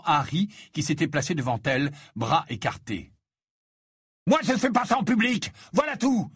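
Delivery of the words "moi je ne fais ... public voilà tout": high, heated retort; raised-pitch quarrelling shout; angry self-defence. A narrator's reading. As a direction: defend yourself indignantly. Ron shouts back at sister.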